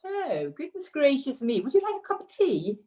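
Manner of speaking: high, fluting mock-British politeness; British accent. Shown as a demonstration. Guest performs for host on request, hammed-up British accent. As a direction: put on an exaggerated, high-pitched British accent. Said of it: "most British thing you can say in a British accent".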